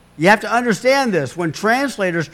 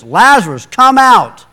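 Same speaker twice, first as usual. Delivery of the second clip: loud, commanding call, higher on come out; 5 semitones higher, 9 dB louder than usual. Acted. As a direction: call him out of the tomb at full voice. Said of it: "called out in a loud voice".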